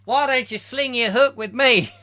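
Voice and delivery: high, loud hammed-up Australian bloke; mock Australian accent. Mocking Pete's Dragon as Australian, hammed-up Australian accent. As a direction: belt it out in an over-the-top Australian accent.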